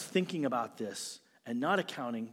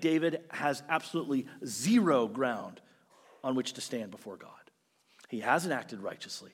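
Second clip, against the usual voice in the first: usual speaking voice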